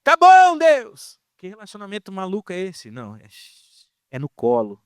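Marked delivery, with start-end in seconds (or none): 0.0-1.2 much higher, louder shouted reply; yelling back. Retold or imitated. Believer yelling back at a distant God, loud. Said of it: "você grita".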